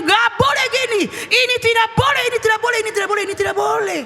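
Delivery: high, rapid-fire parental forbidding; insistent prohibition. Acted. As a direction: hammer the refusal over and over. Voices parent forbidding, repeated insistently. (melarang dengan tegas berulang-ulang)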